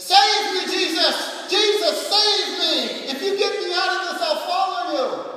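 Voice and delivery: high, loud desperate pleading; screaming for rescue. Retold or imitated. Drunk driver being cuffed screams to Jesus, desperate loud pleading. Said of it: "he starts screaming out".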